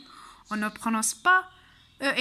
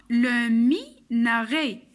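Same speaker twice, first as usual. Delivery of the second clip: "لو ميناري" broken into slow syllables, ending in é; drawn-out, emphatic teacher's model; slow model word; 4 dB louder, slower than usual. Shown as a demonstration. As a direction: sound out "لو ميناري" piece by piece. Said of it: "في هاد الكلمة".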